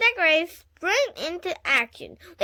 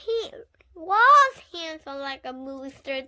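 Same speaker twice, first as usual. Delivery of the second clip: ordinary delivery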